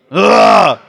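loud, high manly grunt. Real, not acted. Preacher leading a man grunt for the crowd, loud grunt. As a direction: grunt it out like a caveman. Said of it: "give me a man grunt".

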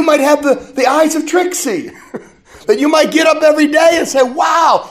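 bursting wow, shouted up high; joyful shout. Real, not acted. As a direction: shout the wonder of a new day. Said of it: "preaching joy at full force".

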